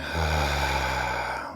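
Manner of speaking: long, airy exhaling sigh; slow releasing sigh; deep sigh. Shown as a demonstration. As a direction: let all the breath out in a deep sigh. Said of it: "deep sigh".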